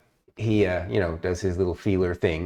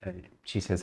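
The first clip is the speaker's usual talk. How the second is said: usual speaking voice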